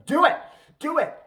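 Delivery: loud, high burst of ordering; sudden hammed-up shout; mock shouted command. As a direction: yell it like an exasperated fake master. Mimicked dominator escalating his orders.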